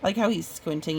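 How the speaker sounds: usual speaking voice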